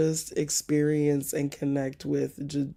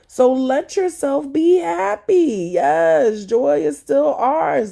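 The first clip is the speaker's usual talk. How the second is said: higher and louder, joyful call; excited, uplifting; 9 semitones higher, 8 dB louder than usual. Real, not acted. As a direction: lift them up with joy. Exhorting the congregation to joy.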